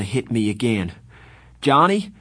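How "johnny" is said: higher, louder call. Acted. Pony calling Johnny in the lot, loud. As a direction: call him from a distance. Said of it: "I called".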